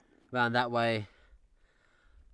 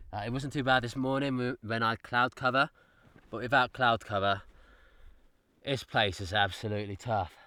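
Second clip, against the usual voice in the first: ordinary delivery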